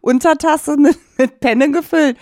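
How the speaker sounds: talking through laughter; high, breaking into laughs; laughing. Real, not acted. Lacht über kleine Portion. (lachend gesprochen)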